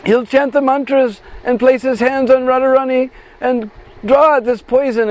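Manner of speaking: put-on a touch of a frantic young friend; lightly voiced urgent girl. Bisakha urges calling the doctor.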